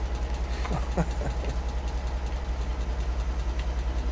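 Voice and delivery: airy unvoiced laughter; breathy laugh. Real, not acted. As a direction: laugh silently at yourself. Laughing after speaker impression.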